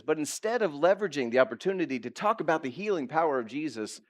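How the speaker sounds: normal talking voice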